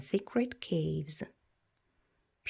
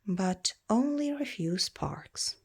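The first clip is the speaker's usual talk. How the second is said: ordinary delivery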